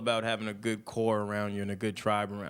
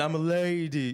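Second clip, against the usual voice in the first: "I'm a lady" from Martin, higher; quoting Martin in a lightly put-on voice; Martin quote; 4 semitones higher than usual. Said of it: "it's from Martin".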